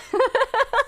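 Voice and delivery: high giggling burst; amused giggle at own story; high laugh. Real, not acted.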